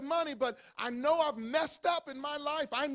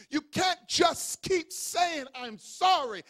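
loud, slow, high emphatic preaching; shouted preaching; 4 semitones higher, 5 dB louder, slower than usual. Acted. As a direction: shout it at the congregation.

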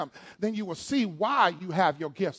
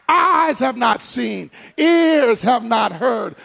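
slow, loud, drawn-out scripture; preaching at full force; 4 semitones higher, 7 dB louder, slower than usual. Acted. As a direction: thunder the verse out slowly.

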